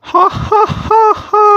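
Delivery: high, slow witch laugh; evil witch cackle. Acted. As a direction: cackle like the queen of devils. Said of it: "আমি হলাম শয়তানের রানী কটকটি". (ডাইনির অট্টহাসি)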